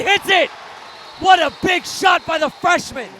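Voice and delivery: loud, high thrilled play-by-play; excited call. Real, not acted. Commentator calling the freshman's big three, excited shout. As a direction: erupt as the shot drops.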